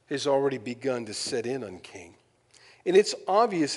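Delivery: ordinary talk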